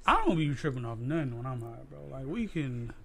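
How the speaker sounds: ordinary talk, nothing marked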